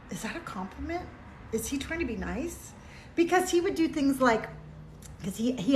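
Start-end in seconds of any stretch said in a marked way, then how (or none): 0.1-2.7 puzzled aside. Retold or imitated. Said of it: "I would be like".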